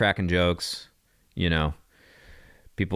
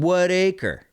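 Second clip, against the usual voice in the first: higher, punchy What acre; cheeky comeback; 8 semitones higher than usual. Retold or imitated. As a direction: fire back the joke with a smirk. Snappy comeback on the bus.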